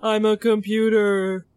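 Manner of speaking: spooky computer voice, lightly done; robot voice. Retold or imitated. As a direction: echo it like a creepy computer. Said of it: "this scary robot voice".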